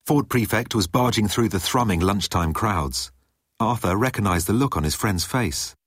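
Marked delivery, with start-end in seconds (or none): none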